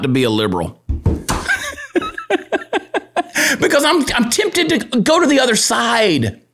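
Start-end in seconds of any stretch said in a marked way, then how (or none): 0.9-3.5 high laugh. Real, not acted. Laughs at his own joke. 3.6-6.4 laughing. Real, not acted. Joking, laughing through it.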